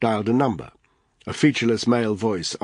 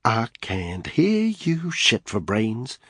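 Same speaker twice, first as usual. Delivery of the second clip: sing-song voice; 3 semitones higher, much slower than usual. Acted. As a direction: tease it out in a lilt. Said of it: "he said in a sing-song voice".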